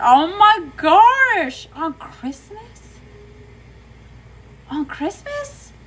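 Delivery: stunned, stretched-out high questioning; shocked disbelief. Real, not acted. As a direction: react in real shock, slow and high-pitched. Shocked reaction to viewer's Christmas breakup news.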